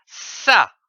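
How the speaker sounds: "Ça" spoken slowly and distinctly as a model; clear syllable demo. Shown as a demonstration. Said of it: "C, c'est dit A, ça".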